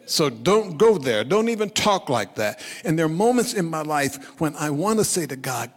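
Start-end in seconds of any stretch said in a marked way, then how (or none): 0.1-2.6 louder, higher pleading objection; acted protest. Peter, afraid of the cross, telling Jesus off loudly. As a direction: protest urgently on a raised voice.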